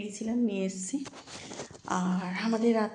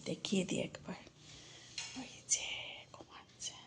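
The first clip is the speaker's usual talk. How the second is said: hushed whisper so the dog stays asleep; real whisper beside a sleeping pet; 3 semitones lower, 12 dB quieter than usual. Real, not acted. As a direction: breathe the words without voice.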